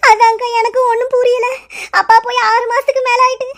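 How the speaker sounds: high-pitched sobbing lament; crying. Acted. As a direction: sob through the words as a deserted daughter. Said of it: "அழாத".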